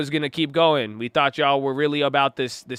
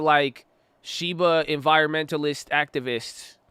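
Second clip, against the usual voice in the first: ordinary talk, nothing marked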